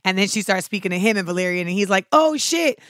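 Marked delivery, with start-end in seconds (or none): 2.1-2.8 high, startled; sudden alarmed surprise; mock shocked slaver.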